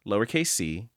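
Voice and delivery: ordinary talk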